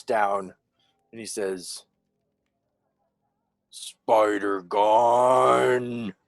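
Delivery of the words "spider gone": slow, drawn-out giant's voice; huge slow monster intoning each word; giant colossus. Acted. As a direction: boom it out long and slow like a colossus. Giant man looks down and speaks.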